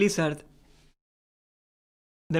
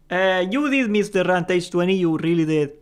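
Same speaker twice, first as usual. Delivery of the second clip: ordinary talk, nothing marked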